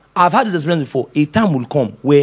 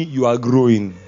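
gentle praise; 7 semitones lower than usual. Acted. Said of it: "the Holy Ghost telling me".

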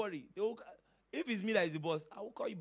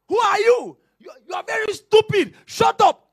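high, loud yelling at a wife; man yelling at his wife, furious; furious shout; 22 dB louder than usual. Retold or imitated. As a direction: scream insults in a rage. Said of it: "you just start shouting".

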